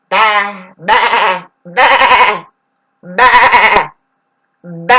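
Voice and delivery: loud repeated ba syllables; ghostly ba chant. Shown as a demonstration. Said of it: "They start sounding like that".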